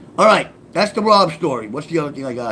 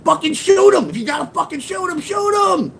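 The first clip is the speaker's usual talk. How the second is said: frantic shout; 14 semitones higher than usual. Retold or imitated. Narrator yelling at frozen partner, high frantic shout. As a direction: scream at him to just pull the trigger. Urging partner mid-robbery.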